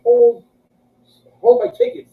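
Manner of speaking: loud, high, acted-out instruction; retold calling out. Storyteller handing tickets to Patty, calling out. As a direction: call it out with a pause after the first word.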